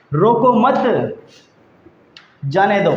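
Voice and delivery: रोको मत together, long pause, then जाने दो; pause after मत. Shown as a demonstration. Teacher moving the comma, long pause after रोको मत. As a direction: run रोको into मत, stop, then release जाने दो. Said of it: "अल्प विराम की स्थिति को बदल देंगे".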